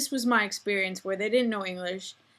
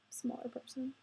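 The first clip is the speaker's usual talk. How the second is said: breathy whispered repeat of "Smaller person"; whispered echo; 4 semitones higher, 14 dB quieter than usual. Shown as a demonstration. Vlogger whispers her own words back, self-pitying whisper.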